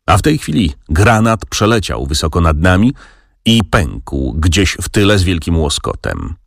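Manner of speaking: ordinary delivery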